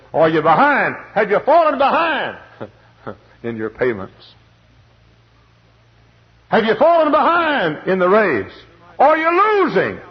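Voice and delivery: high, loud, shouted questions; full-force preaching. Acted. Preacher challenging the congregation, high and loud. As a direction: challenge the crowd at the top of the voice.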